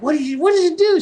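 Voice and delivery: high, loud imitated scolding; mock outraged mom yelling; angry mom. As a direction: shriek it like a furious mother. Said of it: "She's all pissed off".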